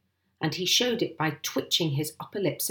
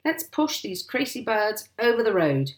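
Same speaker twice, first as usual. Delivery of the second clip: higher, spirited call; hearty raised-voice encouragement; rallying cry; 5 semitones higher, 4 dB louder than usual. A narrator's reading. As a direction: urge the men on. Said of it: "he cried".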